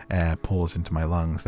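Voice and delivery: ordinary delivery, nothing marked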